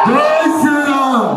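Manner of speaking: slow, drawn-out rally cry; slogan shout. Real, not acted. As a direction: call it out for the crowd to answer. Rousing crowd to answer louder. (నినాదం సాగదీసి అరవడం)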